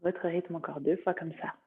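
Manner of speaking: calm yoga nidra guidance, long pauses between phrases; guided meditation. Acted. Teacher guiding students through body sensations, meditative voice.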